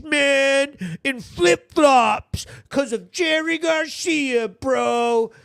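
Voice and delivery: high, slow, shouted mock pride; hammed-up proud dad yelling about his boy; mock proud parent. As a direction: brag like a parent showing off a foolish son. Proud parent voiced mockingly.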